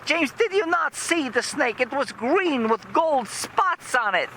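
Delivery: high-pitched indignant mother; imitated panicky woman insisting; mock frantic wife. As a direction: pitch it high, exaggerated alarm. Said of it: "she says".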